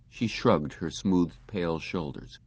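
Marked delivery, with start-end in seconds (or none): none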